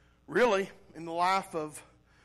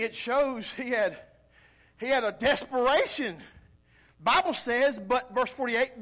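usual speaking voice

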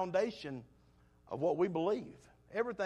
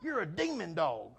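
scolding the dog; 5 semitones higher, 5 dB louder than usual. Retold or imitated. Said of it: "I said".

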